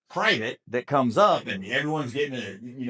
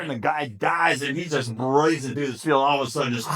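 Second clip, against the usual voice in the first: normal talking voice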